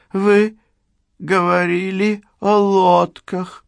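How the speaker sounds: put-on high, slurry drunk woman voice; town drunk. A narrator's reading. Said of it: "пьяным голосом напомнила Черил".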